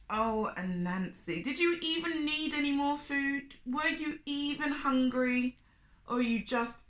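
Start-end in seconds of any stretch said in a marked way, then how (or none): none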